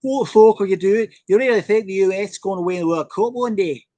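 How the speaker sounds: mock English pundit voice jeering at US hopes; Carragher impression. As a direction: channel a scornful English pundit in a mock accent.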